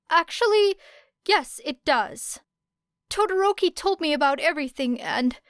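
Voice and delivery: put-on a shy boy steeling himself; a lightly voiced nervous boy. A narrator's reading. Midoriya standing up to Era.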